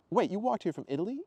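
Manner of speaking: high-pitched incredulous question; astonished double-check; disbelief. Acted. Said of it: "Ethan said in disbelief".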